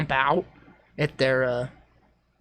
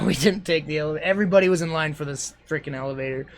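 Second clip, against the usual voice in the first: ordinary talk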